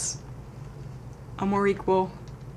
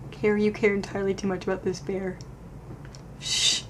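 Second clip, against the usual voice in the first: ordinary talk, nothing marked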